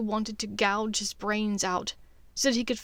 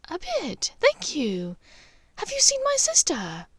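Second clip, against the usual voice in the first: higher, lighter woman's voice; Narcissa voice; 9 semitones higher than usual. Acted. Draco posing as Narcissa, answering Lucius in a higher woman's voice. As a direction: Draco faking his mother's voice.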